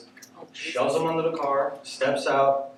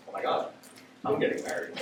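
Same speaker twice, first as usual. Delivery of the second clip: nervous whisper; 9 dB quieter, faster than usual. Retold or imitated. Nervous inner thought.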